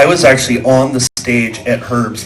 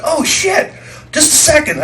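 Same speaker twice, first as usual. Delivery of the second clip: startled; 14 semitones higher than usual. Retold or imitated. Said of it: "And I went".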